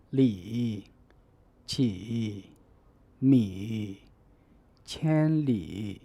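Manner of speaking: unchanged Mandarin third tone on 里 起 米 千里; full third tones. Shown as a demonstration. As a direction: give every word its whole dip and rise. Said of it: "The tone remains unchanged when pronounced alone".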